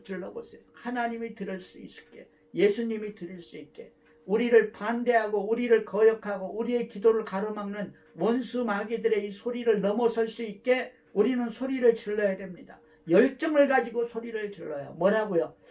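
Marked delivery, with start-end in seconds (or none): none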